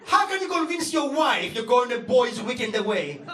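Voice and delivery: normal talking voice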